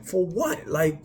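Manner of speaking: pitched well up, incredulous and frustrated; high, disbelieving outburst; exasperated rant. Retold or imitated. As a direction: demand answers from an absent God. Ranting at God after the crash.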